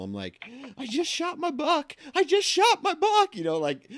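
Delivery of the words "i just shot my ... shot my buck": high and pitchy. Retold or imitated. Hunter telling his wife about the buck, high pitchy excited voice. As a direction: voice cracking high and loud with excitement. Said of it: "my voice gets real high and pitchy".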